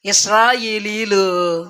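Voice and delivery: ordinary delivery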